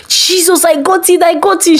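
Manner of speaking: high, thrilled cry of joy; excited scream. A narrator's reading. Farida bursting in to tell co-workers, high excited cry. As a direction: shout the good news, breathless with joy. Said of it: "she screamed at the co-workers".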